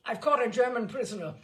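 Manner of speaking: loud triumphant cry. A narrator's reading. General calls to the home guards, loud and triumphant. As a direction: call it out in triumph. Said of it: "cried the general".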